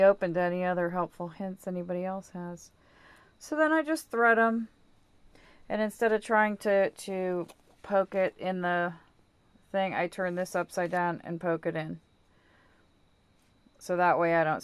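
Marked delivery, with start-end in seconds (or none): none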